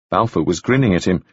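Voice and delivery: ordinary delivery, nothing marked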